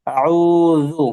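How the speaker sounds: আউযু with a clear dhal and long vowels; loud, drawn-out model of আউযু; makhraj demonstration. Shown as a demonstration. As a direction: chant আউযু loudly, articulating the dhal. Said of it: "দালের মাখরাজটা আদায় করবেন".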